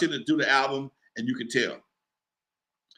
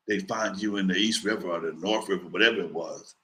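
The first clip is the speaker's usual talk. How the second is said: low, quiet mobster-style threat; a much lower voice for the caller; menacing caller; 8 semitones lower, 4 dB quieter than usual. Retold or imitated. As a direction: speak down low like a Jersey heavy making a threat. Menacing New Jersey caller.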